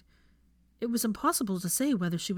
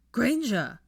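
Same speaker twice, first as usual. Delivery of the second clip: shouting; 5 semitones higher, 6 dB louder than usual. Acted. Calling in the woods.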